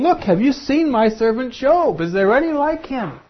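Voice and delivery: God boasting to the angels in His court. Acted. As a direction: show off your servant like a proud father. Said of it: "God is boasting and saying".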